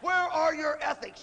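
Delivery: high, drawn-out mock outrage; protesting conscience. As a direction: scold like a horrified conscience. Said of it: "And this voice going".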